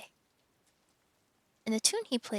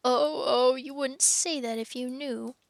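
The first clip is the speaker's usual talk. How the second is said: loud sobbing oh then broken words; a touch of sobbing fawn; between sobs; 3 semitones higher, 4 dB louder than usual. Acted. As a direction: cry it through the line. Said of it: "between his sobs".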